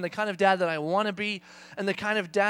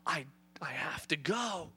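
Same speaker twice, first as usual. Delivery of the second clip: thinking to himself; 4 semitones lower, 8 dB quieter, slower than usual. Retold or imitated. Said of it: "I'm thinking to myself".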